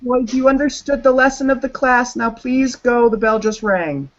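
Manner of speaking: high, sing-song teacher voice dismissing a class; mock teacher. Telling the cops they may leave, high mock teacher voice. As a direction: pitch it way up like a primary teacher sending kids off. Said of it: "I was like".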